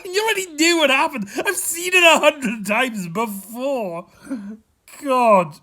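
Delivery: laughing through tears. Real, not acted. Fan mocking own tears over a show, laughing and teary. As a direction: laugh at yourself while still crying. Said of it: "I get emotional about a T V show".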